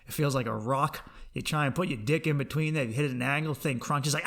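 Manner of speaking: normal talking voice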